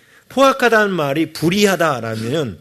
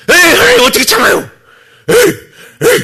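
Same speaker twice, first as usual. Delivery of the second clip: much higher and louder, whining back at God; angry protest; 14 semitones higher, 9 dB louder than usual. Pretend. Preacher playing an angry spouse arguing back at God. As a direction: fling it up in exasperated protest. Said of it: "막 이렇게 화가 나는데".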